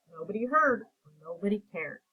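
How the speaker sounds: ordinary delivery, nothing marked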